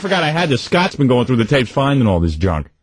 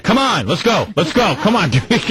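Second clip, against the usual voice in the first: higher-pitched impatient hurrying; uptight dad; 6 semitones higher than usual. Retold or imitated. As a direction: imitate an uptight father rushing everyone along. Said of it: "my father would be real uptight".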